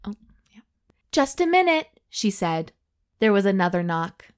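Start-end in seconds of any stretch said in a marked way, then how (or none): 1.1-1.9 raised-pitch call through a door. A narrator's reading. Doctor answers a knock on the toilet door, calling out.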